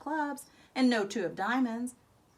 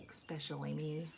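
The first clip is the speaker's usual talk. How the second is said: soft aside; 8 semitones lower, 11 dB quieter than usual. A narrator's reading. Confiding aside after whisper.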